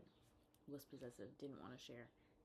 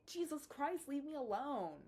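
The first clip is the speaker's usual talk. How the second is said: exasperated outburst; 8 semitones higher, 7 dB louder, slower than usual. Real, not acted. Fed up with clingy guy.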